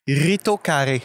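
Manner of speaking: slow, drawn-out try at Italian; attempted Italian accent. Guest repeating the word to guess it, Italian accent. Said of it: "mais avec un accent, mettons".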